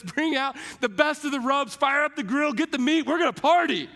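father throwing a welcome feast, excited. Retold or imitated. Dad to the servants, calling for a party, excited. Father voiced celebrating son's return.

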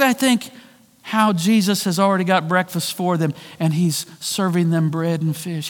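usual speaking voice

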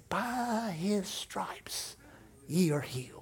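a faint whispered promise from deep inside; tiny whisper. Retold or imitated. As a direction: barely breathe the scripture like a tiny inner voice. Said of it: "a little bitty voice that said".

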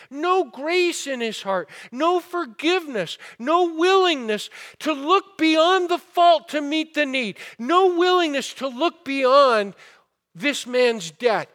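fiery sermon climb; preaching at full force. Acted. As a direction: build the condemnation to a peak.

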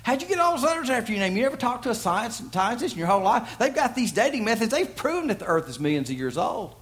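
high, mocking know-it-all voice; skeptic voice. Skeptic voiced in imagined chat.

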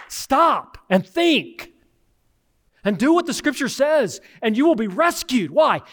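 high, urgent preacher's call; preaching at full force. Acted. Pastor urging the congregation to stop and think, preaching hard. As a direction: lift the whole church up with it.

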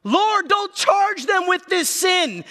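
high, loud, slow cry to heaven; desperate shout. Acted. Dying Stephen shouting a prayer for his killers.

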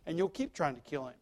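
usual speaking voice

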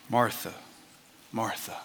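quiet, loving correction; gentle rebuke. Retold or imitated. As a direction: call her name kindly before correcting. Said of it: "a very gentle rebuke".

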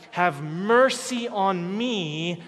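loud, drawn-out plea for mercy; retold crying out. Canaanite woman begging Jesus for mercy, crying out. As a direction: cry out slowly and loudly. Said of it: "and was crying".

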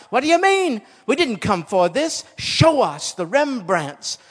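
high, loud crowd protest; outraged heckler shouting demands at an auctioneer; indignant shout. Acted. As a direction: shout it out for the whole angry room. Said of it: "shouted out".

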